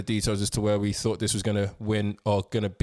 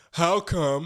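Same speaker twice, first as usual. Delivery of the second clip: Trump impression; 7 semitones higher than usual. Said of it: "Let me try again".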